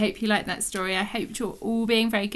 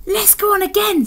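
high, loud, eager bear voice; eager little bear; 12 semitones higher, 7 dB louder than usual. Acted. Alan begging for another ride, eager bear voice. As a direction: pipe up, keen for another turn.

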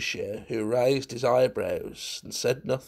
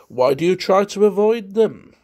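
louder, raised, pointed question; rather grim; 7 semitones higher, 7 dB louder than usual. A narrator's reading. Munt questions Valentine about avoiding them, rather grimly. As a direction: ask it with a touch of grimness. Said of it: "Munt asked rather grimly".

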